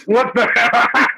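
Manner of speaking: high, loud, drawn-out echo breaking into laughter; slow, high-pitched mock shock, laughing; laughing mock disbelief. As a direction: mimic the outburst while cracking up. Mimicking the quote, cracking up.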